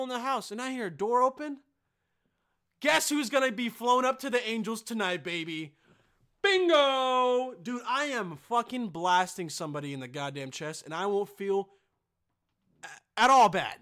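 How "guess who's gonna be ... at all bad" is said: loud taunting threat; menacing yell. Pretend. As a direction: taunt the intruder, loudly. Threatens imagined intruder.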